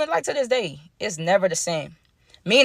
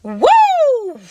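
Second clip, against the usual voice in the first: laugh and woo; 6 dB louder than usual. Real, not acted. Laughing at the story's twist.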